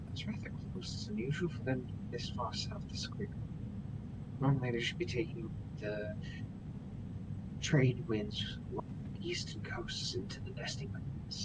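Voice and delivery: hushed, breathy character whisper. Acted. GM voicing Morgan in a hushed whisper. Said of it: "You're whispering".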